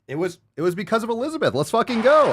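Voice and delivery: high, building to a loud whoop; excited cheer. Real, not acted. Owner cheering his dog's wagging tail, high and loud. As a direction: celebrate the dog's answer.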